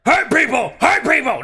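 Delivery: high, forceful command; commanding shout, each phrase punched; barked order. Shown as a demonstration. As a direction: bark it out as an order. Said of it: "That was an order".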